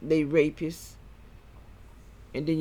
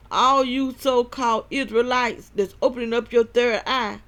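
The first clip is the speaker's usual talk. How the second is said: loud, high fervent preaching; 5 semitones higher, 5 dB louder than usual. Acted. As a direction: preach it hard at the crowd. Preaching against third eye.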